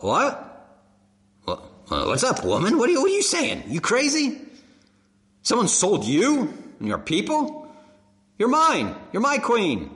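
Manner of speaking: a king baffled his queen was sold; confused king. Acted. Preacher voicing a confused king asking who sold his queen. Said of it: "Maybe he's thinking".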